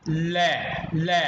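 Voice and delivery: ले said loud and isolated, tongue on teeth; demo syllable. Shown as a demonstration. Teacher showing the tongue on the teeth. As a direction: sound out ले twice. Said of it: "फिर देखिए".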